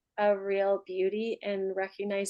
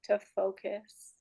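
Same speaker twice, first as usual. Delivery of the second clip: guided meditation; 8 dB quieter than usual. Real, not acted. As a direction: ease them down gently, with long pauses between phrases. Leads a final meditation.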